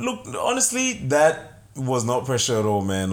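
ordinary talk, nothing marked

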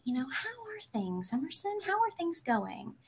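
lightly mimicked counselor, pitched high and soft; sweet counselor voice. Imitating the school counselor asking a student how things are, high and soft. Counselor's check-in question retold.

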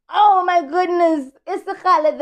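ordinary talk, nothing marked

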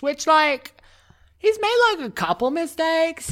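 put-on Valley Girl voice. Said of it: "Keep it running with the Valley Girl".

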